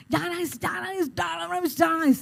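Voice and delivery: high, strained plea to oneself; acted-out fight against crying; urgent self-command. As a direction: hold back tears by ordering yourself. Orders herself not to cry.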